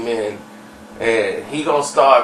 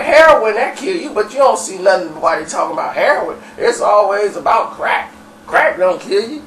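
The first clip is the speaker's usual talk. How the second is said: higher-pitched insistent arguing; agitated rant; 7 semitones higher than usual. Retold or imitated. Dirty comparing heroin and crack, agitated rant. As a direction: press the point, voice raised.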